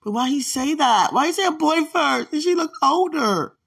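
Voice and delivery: high, loud amazed questions; excited. Real, not acted. Marveling at a prophecy about her kids, excited.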